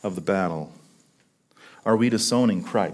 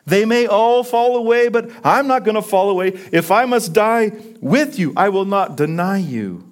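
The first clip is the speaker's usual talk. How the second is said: high, forceful pledge of loyalty; impassioned promise; bold vow; 6 semitones higher than usual. Acted. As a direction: vow it with fervour, pitch high then settling. Peter's vow acted out.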